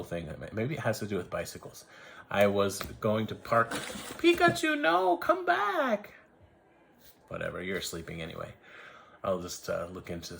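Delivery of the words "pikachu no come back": very high, slow sing-song call; high coaxing call to Pikachu, drawn out slow; calling after a pet. Real, not acted.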